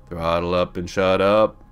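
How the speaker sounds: slow, settled repeat of an order; unhurried, measured muttering to yourself; deliberate self-command. Acted. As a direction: draw the order out slowly. Same command repeated, dropped and slower.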